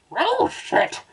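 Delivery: very high-pitched cartoon dog exclamation; exclaiming dog. A narrator's reading. Narrator voices the startled dog in the story, exclaiming high. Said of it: "exclaimed the aroused dog".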